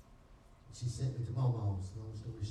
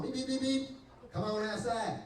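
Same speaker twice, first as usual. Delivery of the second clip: mimicked horn; 9 semitones higher than usual. Mama honking the car horn.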